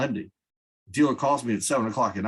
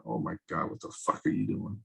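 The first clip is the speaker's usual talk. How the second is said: quiet inner voice; 8 dB quieter than usual. Retold or imitated. Self-realization quoted inwardly.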